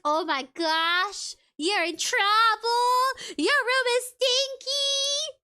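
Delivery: high, slow sing-song taunt; teasing. Retold or imitated. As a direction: tease like a bratty sibling. Teasing a kid in trouble.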